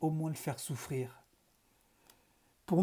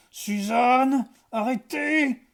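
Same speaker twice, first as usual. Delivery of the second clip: hurlement haut et fort; cri de douleur; 8 semitones higher, 8 dB louder, much slower than usual. A narrator's reading. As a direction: crie comme si le son te brûlait. (professeur torturé qui hurle arrêtez)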